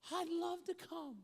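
high, slow, delighted reply; eager acceptance. Retold or imitated. Waitress accepting an invitation to church, eager. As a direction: pitch it way up with enthusiasm.

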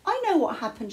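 bursting with happy discovery; excited cry. A narrator's reading. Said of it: "she cried".

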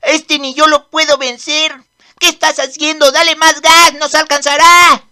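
loud, urgent yelling during the chase; frantic shouting. Acted. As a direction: push the driver hard, panicking. Chase, urging more gas.

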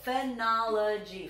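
Phenology slow, four syllables split apart; clapping out syllables. Shown as a demonstration. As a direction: clap each beat of Phenology. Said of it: "Let's clap the syllables".

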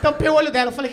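ordinary delivery

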